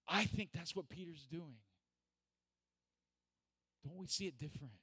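usual speaking voice

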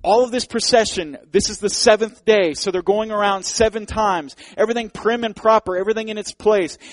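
loud, high, driving sermon voice; full-force preacher painting the scene; fervent preaching. Acted. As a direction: preach it hard to the congregation.

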